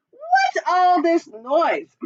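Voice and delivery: high, slow clucking hen voice. A narrator's reading. Henny Penny clucking at the noisy chick. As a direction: cluck it like a fussy old hen. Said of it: "clucked Henny Penny".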